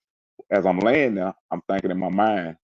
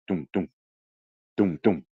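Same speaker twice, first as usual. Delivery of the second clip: calmed heartbeat imitation. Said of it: "my heart say".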